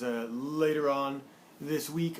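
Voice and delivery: usual speaking voice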